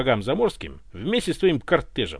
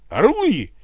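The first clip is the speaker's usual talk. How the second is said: much higher and louder battle cry; furious ringing yell; 6 semitones higher, 9 dB louder, much slower than usual. A narrator's reading. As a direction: bellow it with ringing rage. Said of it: "заорал Иван звенящим от ярости голосом".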